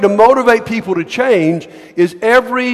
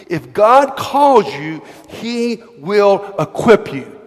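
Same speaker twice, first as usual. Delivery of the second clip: slow, each word weighed; slow emphatic point; much slower than usual. Shown as a demonstration. Preacher slowing down so the church gets the point. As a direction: land the key point slowly and deliberately. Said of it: "here's the point I want you to get".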